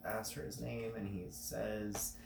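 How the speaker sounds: normal talking voice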